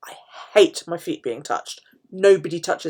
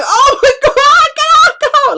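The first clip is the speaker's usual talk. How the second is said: much higher, louder laughing shriek; 12 dB louder, much slower than usual. Retold or imitated. Ticklish foot probed by physio, shrieking laughter. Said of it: "I'm laughing all at the same time".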